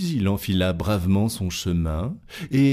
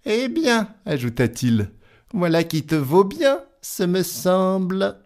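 scoffing tailor; 11 semitones higher than usual. Acted. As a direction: brush it off as child's play. Tailor boasting.

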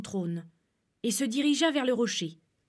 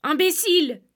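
high, loud angry retort; angry insult; 7 semitones higher, 7 dB louder than usual. Acted. Wife scolds her lying husband, angry. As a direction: spit out the insult in anger. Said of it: "dit la femme en colère".

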